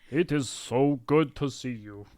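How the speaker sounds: lightly put-on Russian accent; a hint of stagey Russian giant; fake Russian accent. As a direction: greet an old friend in fake Russian. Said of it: "opposite of fake Russian".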